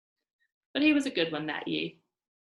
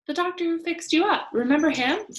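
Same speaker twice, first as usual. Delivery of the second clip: higher and louder, pressing the point; 11 semitones higher, 8 dB louder than usual. Acted. Girl reminding her mother of the doctor, high and loud. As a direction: push her to remember.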